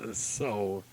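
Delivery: usual speaking voice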